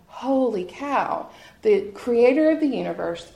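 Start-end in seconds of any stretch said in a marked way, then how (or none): none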